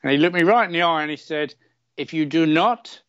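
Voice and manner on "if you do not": put-on slow, grave Polish-accented warning; a lightly voiced Polish doctor. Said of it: "he was Polish".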